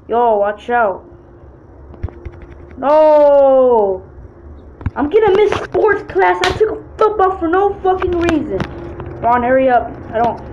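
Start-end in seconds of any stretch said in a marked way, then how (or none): 2.8-4.0 sudden loud cry of No; alarmed shout. Acted. Gamer yelling No as his character falls. 4.9-8.6 put-on higher, frantic kid complaint; whiny panic. Kid voicing panic about missing sports class.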